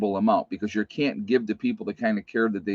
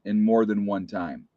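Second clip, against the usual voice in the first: usual speaking voice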